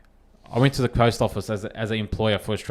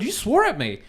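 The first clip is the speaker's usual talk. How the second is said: high-pitched imitation of an offended lady; mock her accusing him, pitched up; indignant accusation; 10 semitones higher than usual. Quoting the lady's accusation.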